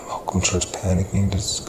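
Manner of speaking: usual speaking voice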